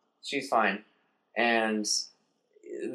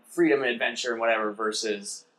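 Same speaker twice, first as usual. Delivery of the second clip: normal talking voice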